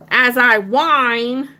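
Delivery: high, slow, drawn-out whine; mock whining. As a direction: stretch every word like a sulky child.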